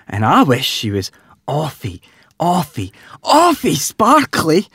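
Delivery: rising to high, slow awfy sparkly; dreamy wishing little pig; Peppa Pig voice. A narrator's reading. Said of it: "added Peppa".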